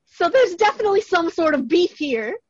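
excited gossip about a secret beef. Real, not acted. Excited gossip about celebrity feud.

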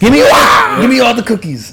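high-pitched hammed-up yell; mock demanding shout. Mimicking the armed customer demanding cookies, mock shouting. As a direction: shriek it like a cartoonish stick-up.

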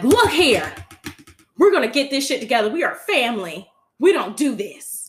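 loud motherly scold, fast at first; mock Mama Tina scolding. Mother Tina scolding her family, loud and firm. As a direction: lay down the law for the family. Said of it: "Mama Tina was like".

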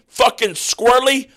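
ordinary talk, nothing marked

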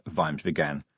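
ordinary delivery

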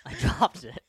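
words squeezed out through laughter; laughing. Real, not acted. Laughing while dunking.

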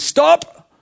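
high, loud command to halt; abrupt high-pitched shout; sudden shout. Retold or imitated. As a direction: cry out urgently to stop him. God halts Abraham's knife at last moment.

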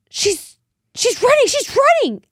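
very high, loud, panicked stammer; hammed-up caller freaking out; frantic older lady. Imitating the lady reporting the runaway dog, frantic and very high. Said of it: "This lady's, like, freaking out".

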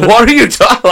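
mock exasperation; exasperated. Complaining about kids talking high, exasperated.

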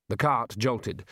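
usual speaking voice

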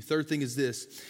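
ordinary talk, nothing marked

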